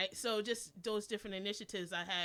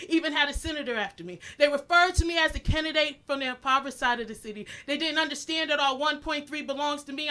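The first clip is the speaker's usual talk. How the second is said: building from strong to a shouted, higher peak; impassioned poem climax; 9 semitones higher, 19 dB louder than usual. Acted. Poet driving her poem to its climax before the crowd, building to a shout.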